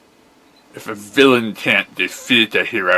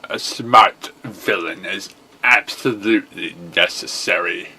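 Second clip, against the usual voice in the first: ordinary talk, nothing marked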